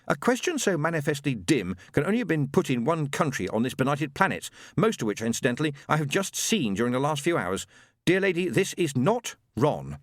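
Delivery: ordinary delivery, nothing marked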